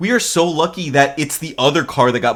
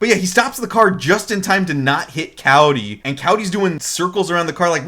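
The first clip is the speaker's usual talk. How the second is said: ordinary talk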